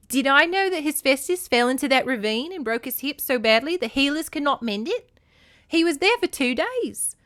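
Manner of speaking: put-on snorting goddess in an Australian accent. A narrator's reading. Hera rebukes Demeter about mothers' bonds, Australian accent. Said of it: "gave Hera an Australian accent".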